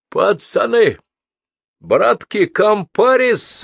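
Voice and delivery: high, loud, drawn-out call; rousing appeal. A narrator's reading. Madman appealing to the passengers, loud calling. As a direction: call out to them like brothers in arms. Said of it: "воззвал он к ненемцам".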